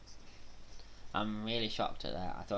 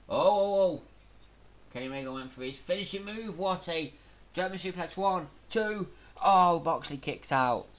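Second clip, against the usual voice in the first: fast, high, excited ringside calling; live play-by-play; 8 semitones higher, 5 dB louder than usual. Real, not acted. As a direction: keep up with every move.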